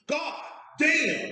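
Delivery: high-pitched, drawn-out exasperation; mock cussing outburst. Drunk customer hearing the price of the shots, mock cussing outburst. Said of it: "he just starts cussing".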